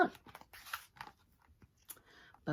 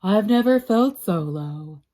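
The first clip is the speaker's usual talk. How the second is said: mopey lament; 8 dB louder than usual. Acted. Spider feels low.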